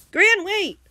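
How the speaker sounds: high, loud urgent yell; warning shout. A narrator's reading. Blake warns friend jumping down, loud warning shout. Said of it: "Blake warned".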